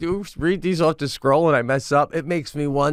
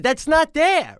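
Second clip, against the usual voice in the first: higher-pitched, mock-baffled objection; incredulous protest; 12 semitones higher, slower than usual. Dad correcting Sadie as she reads, incredulous. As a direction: protest as if catching a misread word. Retold protest while reading along.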